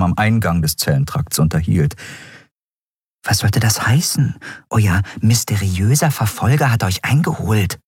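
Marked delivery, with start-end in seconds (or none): 3.2-7.8 puzzled, slightly raised voice; confused. A narrator's reading. Nelen to her friend about the pursuer, confused. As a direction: question it in bewilderment. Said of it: "fragte Nelen hinter ihr verwirrt".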